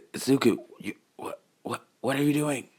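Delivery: stuttering, faltering and hushed; scared stammer. Acted. Terrified Bakugo facing Izuku, hushed stammer. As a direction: stammer it out, backing away. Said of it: "Terrifying Bakugo saying".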